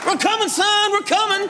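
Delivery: high-pitched call from horseback; high shouted call. Pretend. Jokey horse-ride voice.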